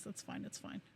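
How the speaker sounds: breathy whisper, resigned; whispered to herself. Real, not acted. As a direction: whisper it under your breath.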